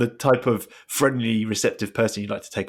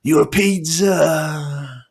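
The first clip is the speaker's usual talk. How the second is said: bad acting; 4 semitones higher, much slower than usual. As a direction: drag out each word menacingly.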